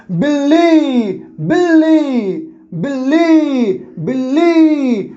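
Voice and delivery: बिल्ली slowly, with a long-held final ली; stress on ली. Shown as a demonstration. Teacher repeating for pupils, stress on ली in बिल्ली. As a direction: stretch and press the ली in each बिल्ली. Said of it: "ली पर ज़्यादा बल लग रहा है".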